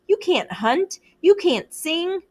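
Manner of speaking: high sneering jabs; taunting. A narrator's reading. Jack taunting Ralph's weaknesses, high sneer. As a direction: needle him with each line.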